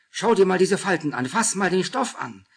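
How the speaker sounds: high, loud, bursting with excitement; eager demand. A narrator's reading. Julia pushing the skirt on Anna, excited and loud. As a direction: gush it out at her friend. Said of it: "forderte sie".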